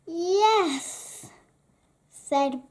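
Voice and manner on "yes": a higher, sly yes; mischievous brother teasing; teasing grin. Acted. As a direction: grin through it. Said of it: "with a grin".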